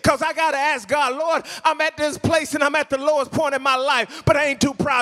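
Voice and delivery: impassioned pleading, high-pitched and insistent; fervent preaching. Acted. Preacher voicing a struggling believer's plea to God, fervent preaching. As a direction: pile up each plea in a driving preacher's cadence.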